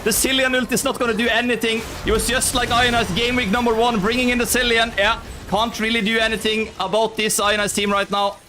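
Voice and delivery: high, sustained hype casting; excited play-by-play. Real, not acted. Caster calling Ionas steamrolling the other team, excited play-by-play. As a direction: ride the excitement through the winning push. Live play-by-play of winning fight.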